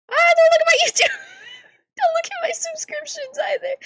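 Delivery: very high, fast panicked squeal; flustered squeal. Real, not acted.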